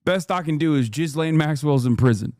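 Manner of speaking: ordinary delivery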